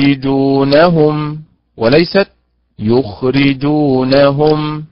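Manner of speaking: slow يخرجونهم, stress moved in the second; teacher contrasting correct and wrong stress. Shown as a demonstration. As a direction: stretch both stresses. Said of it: "كذلك".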